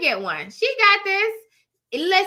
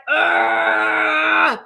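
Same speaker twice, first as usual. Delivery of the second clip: exasperated groan; 3 semitones higher, 6 dB louder than usual. Retold or imitated. Exasperated at complaining people.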